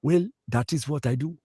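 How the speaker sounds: put-on high, measured Sudanese accent; African accent. Answering the parking-lot guy in kind, high Sudanese accent. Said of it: "I'll do his accent".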